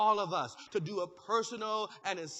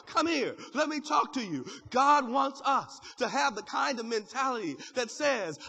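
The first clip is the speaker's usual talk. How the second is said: normal talking voice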